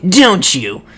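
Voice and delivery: high, drawn-out accusing voice; mock confrontation. Rio accusing a stranger of working for Lan Di, mock indignant. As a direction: challenge him, stretching the words.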